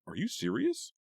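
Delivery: very high, loud incredulous question; disbelief. A narrator's reading. Eva reacts to Tanya's joke, high loud disbelief. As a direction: ask it stunned.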